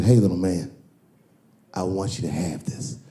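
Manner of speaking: smooth Kevin. Retold or imitated. Kevin gives the son a jersey, low and suave. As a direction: hand it over smoothly, low. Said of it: "He said".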